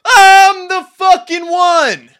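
all-out high rallying scream, drawn out. Shown as a demonstration. Coach urging viewers at home to join in, screaming. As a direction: lead the crowd in a long, huge scream. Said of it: "scream with me".

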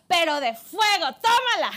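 triumphant. Real, not acted. Triumphant climax of the story.

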